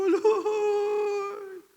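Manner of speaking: high, breaking cry of Lord; tearful cry. Retold or imitated. Drummer weeping as Jesus turns toward him, tearful cry. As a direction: let the voice crack into a sob-laugh. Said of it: "Iyak na ako ng iyak".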